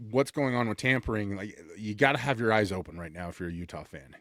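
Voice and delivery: usual speaking voice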